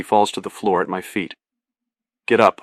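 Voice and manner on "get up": usual speaking voice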